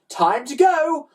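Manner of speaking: high, loud slow command; rally the troops; ordering. A narrator's reading. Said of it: "ordered Ned".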